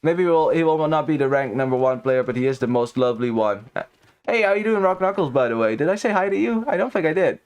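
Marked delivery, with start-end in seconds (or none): none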